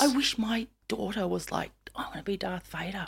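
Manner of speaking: wistful stage whisper; imitating the mums. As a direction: whisper it, imitating an envious mum. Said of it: "The mums have always been like".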